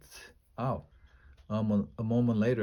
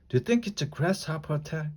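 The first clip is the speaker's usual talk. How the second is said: quick, higher-pitched anxious question; nervous; 6 semitones higher, faster than usual. A narrator's reading. Annie asks about a grasshopper attack, mildly nervous. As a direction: ask it fast and high, a touch nervous. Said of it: "Annie asked nervously".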